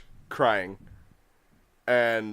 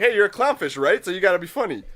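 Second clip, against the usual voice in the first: higher, louder teasing cartoon voice; mock sea turtle; 6 semitones higher, 4 dB louder than usual. Retelling the clownfish joke scene in a higher mock voice. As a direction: tease him like a goofy sidekick. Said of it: "And he's like".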